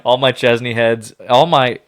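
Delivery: loud, laughing hype call-out; excited shout-out. Real, not acted. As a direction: hype up the fans. Hyped call-out to fans.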